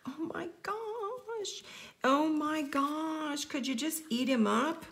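high, slow gushing over something cute. Real, not acted. Crafter coos over her tiny bird, high and slow. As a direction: melt over how cute it is.

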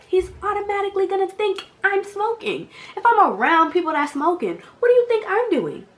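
very high, loud indignation; worked-up, incredulous venting; exasperated rant. Real, not acted. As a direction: argue the obvious at a high, loud pitch.

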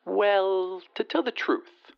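high, haughty lady on the telephone; disdainful lady, pitched well up; haughty rich lady. Acted. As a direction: put on a high lady's voice down the line.

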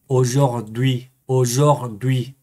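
aujourd'hui drawn out very slowly; careful French pronunciation. Shown as a demonstration. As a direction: model aujourd'hui syllable by syllable in French. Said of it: "Hoy, en francés".

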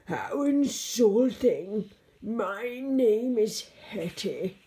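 loud, slow monster outrage; angriest growl. A narrator's reading. Insulted monster corrects George, loud slow angry growl. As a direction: growl it slowly at full volume. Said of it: "with the angriest growl".

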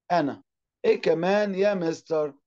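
usual speaking voice

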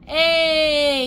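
long, loud, high hey; calling out. Acted. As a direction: stretch it out as an attention-grabbing call. Narrator breaks into a call.

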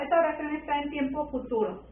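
normal talking voice

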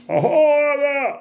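high, loud "oh yeah" burst; loud excited cry; excited whoop. Real, not acted. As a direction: whoop at the new track. Excited shout at new track.

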